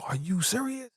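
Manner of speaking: retold high-pitched incredulous question; disbelieving. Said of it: "Rain cannot believe it".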